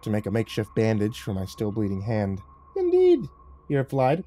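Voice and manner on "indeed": very high, loud exclamation; lofty high-pitched agreement; mocking devil cat. Lucifer replying to the man, high and mocking.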